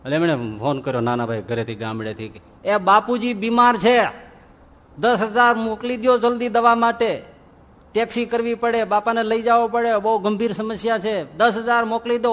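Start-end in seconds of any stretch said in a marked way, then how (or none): 2.7-12.3 higher, louder, pressing plea; urgent phone call. Acted. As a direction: push the brother for money on a bad line. Brother asks by phone for money.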